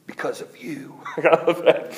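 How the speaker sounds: ordinary delivery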